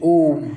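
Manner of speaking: ओम held long, a long drawn-out ओम. Shown as a demonstration. Teacher demonstrating a long drawn-out ओम. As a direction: stretch ओम out to triple length. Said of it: "ऐसा स्वर जिसको बोलने पर ध्वनि लंबी हो जाए".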